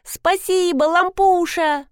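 put-on high, singsong childlike thanks; gleeful, drawn-out, pitched well up; delighted little one. A narrator's reading. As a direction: chant it happily while hopping about. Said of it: "киса начала скакать около покупок, приговаривая".

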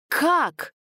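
high, startled question; nervous. A narrator's reading. Friend rushing to help stops short, nervous. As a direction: ask it in nervous disbelief. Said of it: "нервно спросив".

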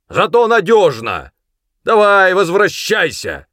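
high, loud calling-back; shouted summons. A narrator's reading. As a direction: shout for someone to return. Sergeant calls boy back. (громко зовёт обратно)

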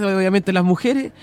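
usual speaking voice

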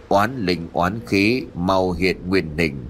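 ordinary delivery

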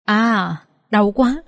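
a faint, pained groan, slowed down; soft groan. A narrator's reading. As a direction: moan weakly in pain. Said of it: "có tiếng rên khe khẽ". (rên khe khẽ vì đau)

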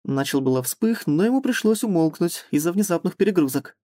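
ordinary talk

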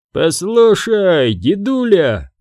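lazily dismissive bouncer; yawning. A narrator's reading. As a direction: drag it out through a mock yawn. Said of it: "зевнув".

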